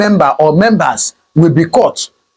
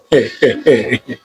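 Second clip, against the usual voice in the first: low, slow-falling chuckle; knowing chuckle; 8 semitones lower, 3 dB quieter than usual. Real, not acted. Commentator imagining Simon Ekpa's return to Nigeria, knowing chuckle.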